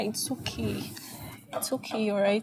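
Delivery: hushed comforting whisper; calm her gently; soothing whisper. Acted. Friend comforting the crying mother, in a soothing whisper.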